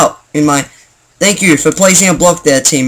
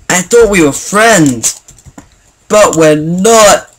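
slow, drawn-out wail of betrayal; overplayed betrayed friend; mock heartbreak; 3 semitones higher, 3 dB louder, slower than usual. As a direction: act hammed-up hurt, stretching the last words. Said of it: "I'm just joking".

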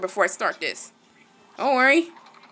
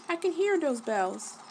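usual speaking voice

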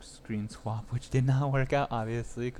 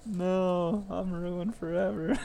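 dramatic whine; 6 semitones higher, 3 dB louder, much slower than usual. Retold or imitated. Quoting a funny video.

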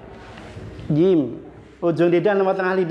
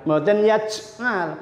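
ordinary delivery

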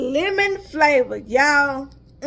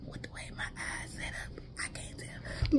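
unvoiced whisper to herself; muttering while squinting at the label; whispered aside; 19 dB quieter than usual. Real, not acted. Reading the can size to herself, whispered.

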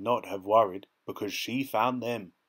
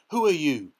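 loud, high demand; Dorothy confronting the old man; 6 semitones higher, 7 dB louder than usual. A narrator's reading. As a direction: insist on an answer. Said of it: "demanded Dorothy".

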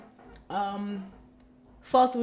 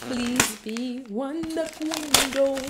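pleading; 3 semitones higher, much slower than usual. Real, not acted. Pleading for the wanted book.

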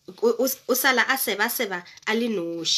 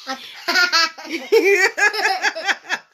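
high, cackling laughter; laughing hard; 6 semitones higher than usual. Acted. Rabbit laughs hard at the beaten fox. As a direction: laugh until you can't stop. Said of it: "Mmutla yena ke a fedile ka ditshego".

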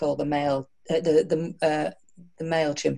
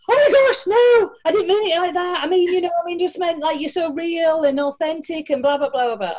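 much higher, louder, flustered gushing; flustered fan; 10 semitones higher, 4 dB louder than usual. Retold or imitated. Imitating the flustered woman fan backpedalling, high and squealy. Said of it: "And then she went".

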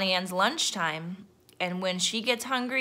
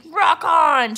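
high, loud pirate crew cheer; 9 semitones higher, 9 dB louder than usual. A narrator's reading. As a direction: cheer along rowdily. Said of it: "cheered the crew".

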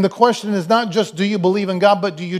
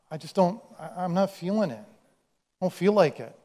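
sulky refusal; 7 dB quieter than usual. Acted. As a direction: mumble it like you can't be bothered. We answer God like sulky kids.

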